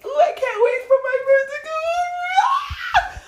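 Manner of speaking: very high, loud excited squeal; happy anticipation. Acted. Friend who can't wait for a visit, squealing happily. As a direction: squeal it with giggly glee. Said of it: "make you so happy if your friend was like".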